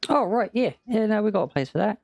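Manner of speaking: high, quick, eager townsman; eager guard. Acted. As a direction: chirp the answer like a helpful guard. Guard answers about medicine.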